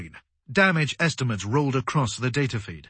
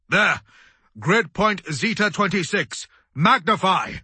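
high, raised barked orders; urgent command; 6 semitones higher, 4 dB louder than usual. A narrator's reading. Commander pointing out a flash to crew, urgent command. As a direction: call out the sighting urgently.

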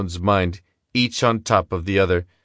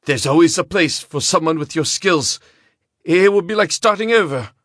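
much higher, desperate persuading; pleading gambler; 9 semitones higher, 3 dB louder than usual. A narrator's reading. Gambler pleading, tempting Gaston.

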